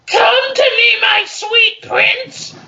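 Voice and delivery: very high, slow swooning voice; hammed-up seductive falsetto. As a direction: croon it like a lovesick maiden. Reading seductive fanfic dialogue.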